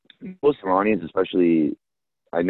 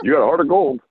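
higher, lightly voiced Obama-style dad with a Persian lilt; a hint of the dad's measured Obama cadence, pitched up; mock Persian Obama dad, pitched higher; 8 semitones higher, 3 dB louder, faster than usual. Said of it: "my dad sounds like a Persian Obama".

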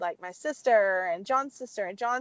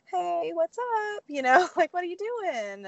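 fake casual; 9 semitones higher than usual. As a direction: act like nothing's up, pitched high. Acting casual on call to mom.

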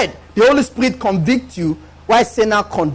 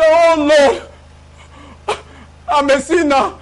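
loud, drawn-out cry to the Lord; hammed-up penitent wail; mock lament; 4 semitones higher, 3 dB louder, slower than usual. Acted sinner crying out to God, mock lament.

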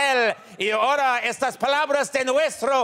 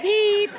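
steady monitor beeps; 9 semitones higher than usual. Acted. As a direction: beep like a calm heart monitor. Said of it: "the beeping becomes normal again".

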